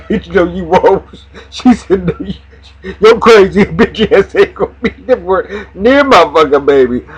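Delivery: mock Anika refusing. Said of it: "Anika said".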